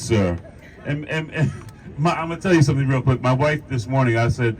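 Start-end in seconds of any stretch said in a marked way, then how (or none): none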